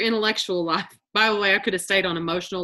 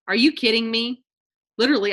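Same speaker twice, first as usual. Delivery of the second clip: usual speaking voice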